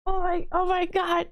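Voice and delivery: stunned disbelief, retold; retelling the owl encounter in awe; amazed. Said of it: "I was like".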